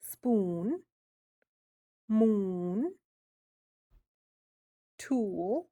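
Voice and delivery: "spoon", "moon", "tool" with long swooping oo vowels; stretched oo. Shown as a demonstration. Teacher modelling the long oo words. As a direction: let each oo swoop and linger. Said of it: "You can hear the oo".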